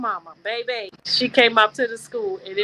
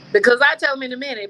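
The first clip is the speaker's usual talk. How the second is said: ordinary talk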